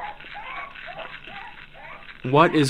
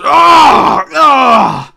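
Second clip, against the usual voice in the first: loud imitated falling scream; mock scream; 10 semitones higher, 10 dB louder than usual. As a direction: scream like you are falling. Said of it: "Have you ever, like, fallen just".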